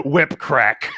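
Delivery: usual speaking voice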